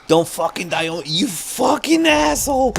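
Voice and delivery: angry yell. Real, not acted. Horse dies, yelling at character.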